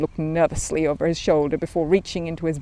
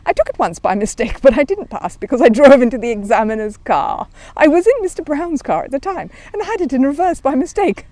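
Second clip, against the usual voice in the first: higher, chatty bear voice; little bear, eager; 9 semitones higher, 8 dB louder than usual. Acted. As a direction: innocent young bear explaining. Paddington voice, eager.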